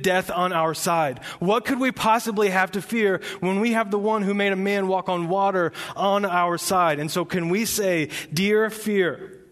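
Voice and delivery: loud, high, rousing sermon delivery; full-force preaching. Acted. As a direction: preach it out, building with fire. Pastor preaching to congregation.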